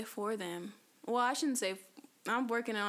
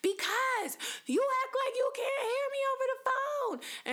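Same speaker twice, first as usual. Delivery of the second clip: high-pitched mock yell, far above own voice; imitating yelling mother. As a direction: screech it like mom yelling down the phone. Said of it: "every time she yells when I'm on the phone".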